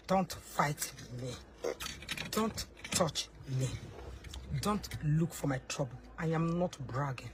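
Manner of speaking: a hushed threat to the mother of a rival; quiet menace. Acted. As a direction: drop your voice down low and threaten. Low veiled threat to rival.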